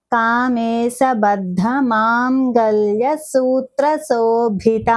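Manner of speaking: కామేశబద్ధ మాంగళ్య drawn out slowly; slow careful recitation. Shown as a demonstration. Teacher reciting the name slowly for learners. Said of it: "slow recitation demo".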